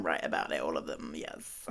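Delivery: whispered with a laugh, conspiratorial; laughing whisper aside; giggly whisper. Real, not acted. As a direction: whisper it like a cheeky secret.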